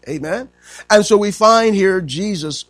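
high, emphatic sermon delivery; impassioned preaching. Real, not acted. Preacher explaining to congregation why Jesus wept, impassioned.

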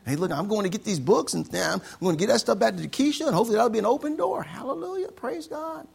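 high, loud, bursting with excitement; excited. Retold or imitated. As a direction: imitate an overjoyed man, voice pushed right up. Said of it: "And he was excited".

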